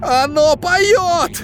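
slow, high strained rasp; hoarse wheeze. A narrator's reading. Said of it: "прохрипел он в камеру".

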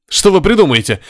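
high, indignant outburst; agitated crewman bursting out; exploding. A narrator's reading. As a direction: blow up at her, voice jumping up. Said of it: "взорвался Горишвили".

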